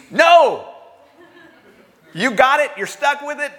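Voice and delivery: a loud, high "No!" then a firm refusal; emphatic divine no. Acted. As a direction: slam the door on the request. God's answer acted emphatically.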